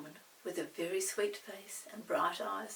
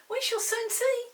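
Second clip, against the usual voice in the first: Molly; 10 dB louder than usual. Acted. Molly speaks, voiced by narrator.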